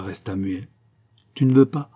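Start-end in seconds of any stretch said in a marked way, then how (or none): 1.3-1.9 short pleading question, higher and louder. A narrator's reading. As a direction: beg with a touch of hurt. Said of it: "murmura Xavier d'une voix suppliante". (d'une voix suppliante)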